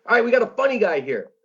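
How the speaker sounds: imitating a host bringing on a comic; emcee intro. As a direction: announce the next act like a club emcee. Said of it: "and he goes".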